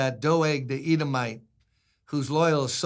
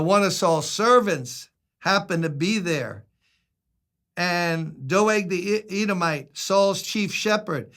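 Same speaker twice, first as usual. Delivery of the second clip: ordinary delivery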